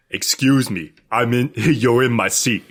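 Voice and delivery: hint of a deep movie tough guy; lightly put-on low action-star voice; Vin Diesel. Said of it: "That's, that's not Vin Diesel".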